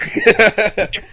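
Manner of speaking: bright loud laughter burst. Real, not acted. Laughing after the joke.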